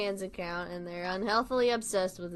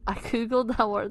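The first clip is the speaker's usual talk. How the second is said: wandering, amateur Scouse; all-over-the-place accent. Acted. Friend describing dad's photo, wandering Liverpool accent. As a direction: Liverpool accent that keeps slipping. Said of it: "an all over the place".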